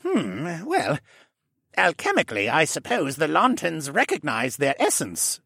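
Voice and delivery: Jenner's voice. A narrator's reading. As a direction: speak up as the fussy, kindly koala gentleman. Jenner muses, narrated.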